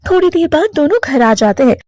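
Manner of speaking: ordinary delivery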